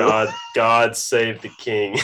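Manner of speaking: slow, drawn-out hammed-up royal salute; slow, mock-grand God save the King; mock solemn toast. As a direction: intone it like a mock-pompous loyal subject.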